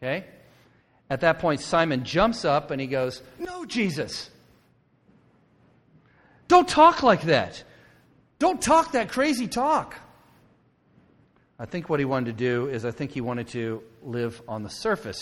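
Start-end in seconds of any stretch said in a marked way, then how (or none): 3.4-10.0 high, drawn-out outcry; loud protest. Acted. Simon jumps up to object to Jesus, loud protest. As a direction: blurt it out as an alarmed, pleading objection.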